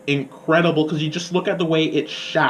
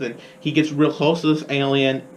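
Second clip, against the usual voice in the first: ordinary talk, nothing marked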